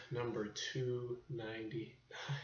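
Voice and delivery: slow, hushed whisper; whispered echo. Pretend. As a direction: echo it back in a drawn-out whisper.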